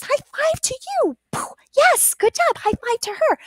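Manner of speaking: high squeaky puppet voice. Shown as a demonstration. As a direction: voice the puppet, bright and quick. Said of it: "it can demonstrate high fives".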